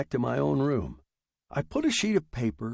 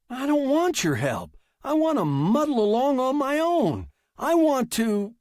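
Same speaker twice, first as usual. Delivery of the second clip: high, loud, heated outburst; shouting; 13 semitones higher, 5 dB louder than usual. Acted. Writer refusing the machine's help, shouting high. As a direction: shout it in rising frustration. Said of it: "Stop shouting".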